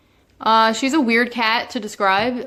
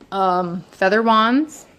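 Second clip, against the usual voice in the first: ordinary talk, nothing marked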